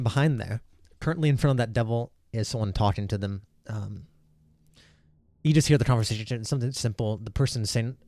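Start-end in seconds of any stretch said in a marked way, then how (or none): none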